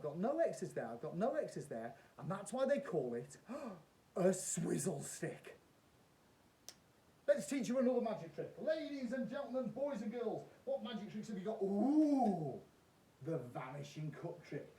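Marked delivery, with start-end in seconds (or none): 3.5-5.6 mock gasp reveal. Gasp before the trick punchline. 7.3-12.6 bright, high showman patter; kids party magician. Acted. Showman address to audience.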